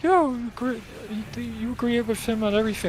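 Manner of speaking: high, fast sarcastic imitation; high mocking voice. Sarcastic jab at a friend, high and fast. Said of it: "It's like".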